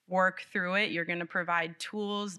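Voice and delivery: usual speaking voice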